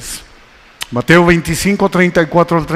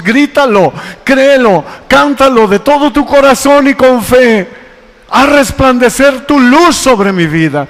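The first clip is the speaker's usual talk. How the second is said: raised, high-pitched preaching cry; rousing call to sing with faith; fervent exhortation; 6 semitones higher, 3 dB louder than usual. Real, not acted. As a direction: urge the congregation on at full voice. Preacher urging congregation to sing out.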